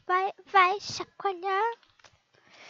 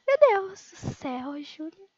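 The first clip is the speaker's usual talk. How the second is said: ordinary talk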